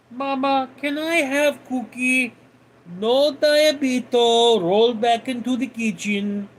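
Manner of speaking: a lightly voiced cartoon family bit; cartoon kid and mom. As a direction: play both the whiny kid and the bossy mom. Kid begs mom, mom scolds.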